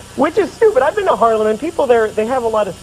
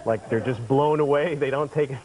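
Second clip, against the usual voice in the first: usual speaking voice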